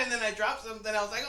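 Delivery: ordinary delivery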